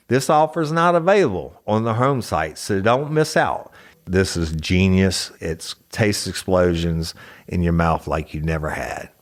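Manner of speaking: loud, punchy sponsor pitch; loud ad read. Acted. Host pushing the offer to listeners, loud ad read.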